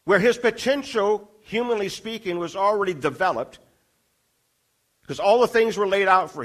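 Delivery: fervent pulpit delivery; preaching at full force. Acted. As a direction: preach it to the whole congregation. Preaching about Moses in desert.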